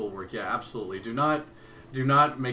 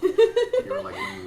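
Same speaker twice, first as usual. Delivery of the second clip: laughing; 10 dB louder, slower than usual. Real, not acted. Laughing at co-host leaning in.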